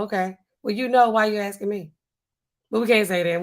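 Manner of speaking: normal talking voice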